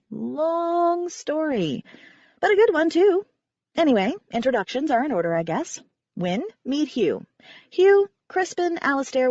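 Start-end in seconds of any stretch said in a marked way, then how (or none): 0.1-3.3 wry, weary aside; drawn-out aside. A narrator's reading. Narrator dodging the tale, drawn-out then bright. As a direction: stretch long story, then a bright lift.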